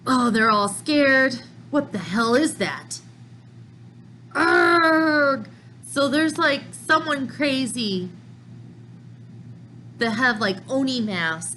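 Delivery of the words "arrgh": loud growling roar; monster roar. Acted. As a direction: let out a beastly roar. Monster attacking in the scene.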